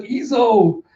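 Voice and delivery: high exclamation of a name; joyful cry. Acted. Samurai celebrating Izo's return, high joyful cry.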